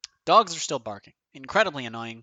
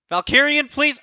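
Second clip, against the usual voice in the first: excited plea; 11 semitones higher, 8 dB louder than usual. Real, not acted. Begging the draw.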